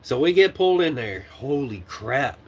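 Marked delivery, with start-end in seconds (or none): 1.3-2.4 slow, drained holy crap; exhausted. Retold or imitated. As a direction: let it out slowly, drained. Reliving the stress.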